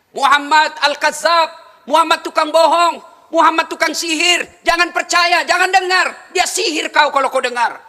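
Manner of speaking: loud, high jeering yells; mocking shout. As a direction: shout insults across the market. Blind man shouting insults daily. (teriakan mengejek yang keras)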